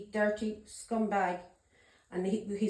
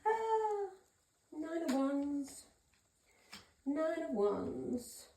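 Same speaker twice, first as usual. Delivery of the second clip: slow soft card reading; 4 semitones higher, 8 dB quieter, much slower than usual. Real, not acted. Slowly reading a drawn card.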